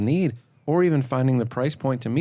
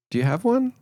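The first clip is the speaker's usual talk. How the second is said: much higher, pleading question; rising insecure question; 12 semitones higher than usual. Shown as a demonstration. Salesman pleading with a client for a pen, much higher. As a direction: beg for the pen. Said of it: "You're saying".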